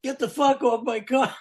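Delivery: imitating Paul yelling from the window; mock yell. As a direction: yell down three stories at the crowd. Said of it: "he's yelling out the window".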